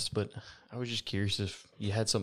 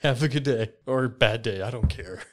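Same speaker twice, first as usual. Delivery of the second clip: cheeky dismissal; 3 semitones higher, 8 dB louder than usual. Acted. Griff dismisses the doorman.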